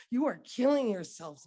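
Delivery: a sudden loud burst of grief; anguished outcry. Acted. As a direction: hurl the accusation out. Poem accusing America as mother.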